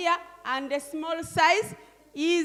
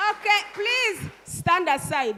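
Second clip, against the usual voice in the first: usual speaking voice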